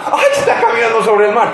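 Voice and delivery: stunned disciple crying out; surprised gasp. Acted. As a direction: blurt it out in astonishment. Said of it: "¿Por qué te sorprendes?".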